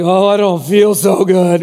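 slow, drawn-out mock queasy moan; queasy overeater. Preacher acts out regretful overeater five minutes later, queasy moan. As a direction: moan it out like someone who overate.

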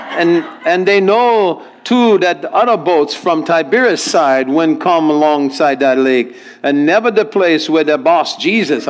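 lilting Hawaiian Pidgin reading. Acted. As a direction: read it out in a Hawaiian Pidgin accent. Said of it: "translation from our English into Hawaiian Pidgin".

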